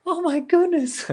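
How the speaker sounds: high, slow startled gasp from the nurse. Retold or imitated. Nurse sees him hit the wall, high startled gasp.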